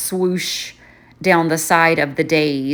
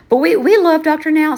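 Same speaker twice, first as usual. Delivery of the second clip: gushing; 10 semitones higher, 6 dB louder than usual. Real, not acted. Gushing about favorite TV doctor.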